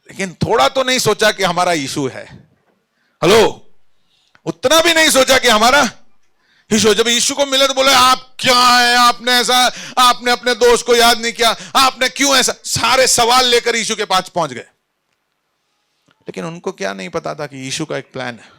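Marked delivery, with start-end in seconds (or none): 4.4-14.7 high, insistent pulpit delivery; full-force preaching. Acted. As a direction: preach it out to the congregation.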